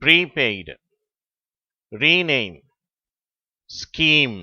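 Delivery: ப்ரீபெய்டு, ரீநேம், ஸ்கீம் each with a drawn-out "ee"; long vowel. Shown as a demonstration. Teacher reads the third row, long-vowel demo. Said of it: "நெடில் ஓசையோட உச்சரிக்கப்படுகிறது".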